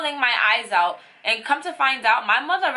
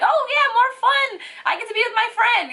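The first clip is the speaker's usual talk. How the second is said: much higher, louder, eager puppy chatter; happy puppy voice; 3 dB louder than usual. Retold or imitated. Voicing the puppy, much higher, louder, excited puppy voice. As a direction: squeal it like an excited little dog. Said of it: "he's just like".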